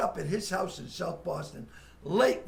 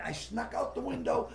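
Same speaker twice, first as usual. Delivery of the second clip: normal talking voice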